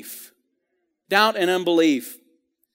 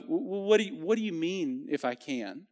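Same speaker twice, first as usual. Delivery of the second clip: soft, much quieter, tender question; gentle, hushed compassion; compassionate; 8 dB quieter than usual. Shown as a demonstration. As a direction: coax the answer out gently. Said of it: "more compassion of".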